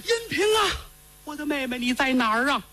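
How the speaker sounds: high piercing wail for his sister; midnight wail. Acted. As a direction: cry out into the dark for her. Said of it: "深更半夜突然间坐起来了".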